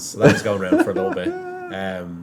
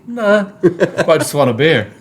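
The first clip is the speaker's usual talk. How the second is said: laughing; 9 semitones higher, 8 dB louder than usual. Retold or imitated. Quoting himself through laughter.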